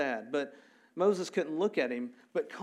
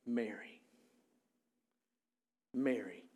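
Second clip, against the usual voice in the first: soft, low, near-whispered name; hushed, intimate calling; tender hushed calling; 4 semitones lower, 5 dB quieter than usual. Acted. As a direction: say the name gently, letting it hang. Jesus tenderly calls Mary by name.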